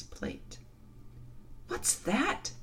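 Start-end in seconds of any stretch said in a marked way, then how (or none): 1.7-2.5 alarmed. A narrator's reading. Frightened girl hears loud noise.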